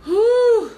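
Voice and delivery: loud high exhale of relief; relieved whew. Real, not acted. Relief after getting it off her chest, loud whew. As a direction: let it all out with a whoosh.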